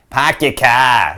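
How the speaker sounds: loud, slow mock Boston accent. Shown as a demonstration. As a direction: drag out a Boston accent like an angry duck. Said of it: "A Boston accent is some version".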